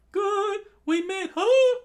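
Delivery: very high, loud falsetto; squeaky cartoon cry. Kid voicing a panicked character in a squeaky falsetto.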